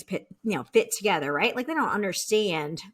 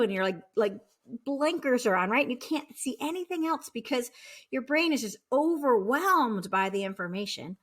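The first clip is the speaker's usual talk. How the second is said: ordinary talk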